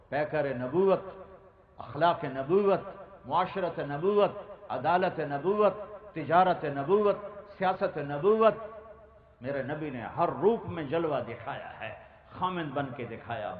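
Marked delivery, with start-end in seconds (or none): 0.1-8.5 building preaching. Acted. Preaching at full force.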